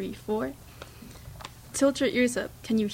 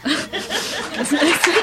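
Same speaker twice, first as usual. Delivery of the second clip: laughing; 9 semitones higher, 9 dB louder, much slower than usual. Real, not acted. Laughing after ending story.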